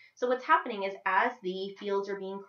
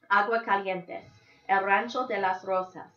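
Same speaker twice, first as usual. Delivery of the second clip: Spanish place names; 6 dB louder than usual. Acted. Esperanza names her home.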